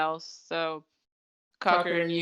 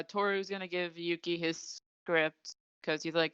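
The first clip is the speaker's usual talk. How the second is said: normal talking voice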